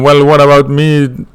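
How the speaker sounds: ordinary talk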